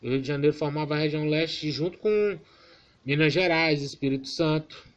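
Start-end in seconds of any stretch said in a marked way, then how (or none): none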